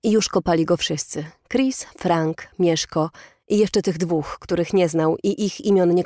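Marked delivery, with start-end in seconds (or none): none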